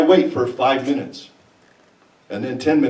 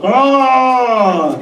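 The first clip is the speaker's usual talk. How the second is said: cry of pain; 5 semitones higher, 3 dB louder than usual. Retold or imitated. Needle goes in.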